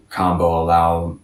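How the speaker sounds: ordinary delivery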